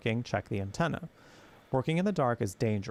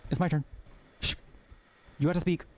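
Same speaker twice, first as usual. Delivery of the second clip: wife's voice; 5 semitones higher, faster than usual. Acted. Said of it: "the wife said".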